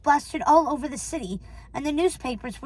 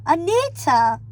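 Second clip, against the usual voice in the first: higher, drawn-out summons; raised, sing-out name call; 4 semitones higher, 4 dB louder, slower than usual. Acted. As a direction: call someone to the phone. Man calls wife to phone.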